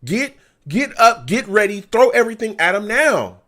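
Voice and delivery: loud, urgent demand; frustrated shout. Real, not acted. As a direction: bark it like you're on the sideline. Yelling at coaches from stream.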